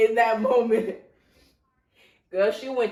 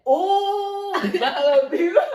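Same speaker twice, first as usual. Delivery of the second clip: imitating sister; 9 semitones higher, 7 dB louder, much slower than usual. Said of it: "she said".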